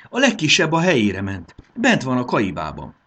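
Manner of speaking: high, loud excited report; exclaiming. A narrator's reading. Marci tells Fanny the smallest cow went in, exclaiming. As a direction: call out the news with excitement. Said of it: "kiáltott fel Marci".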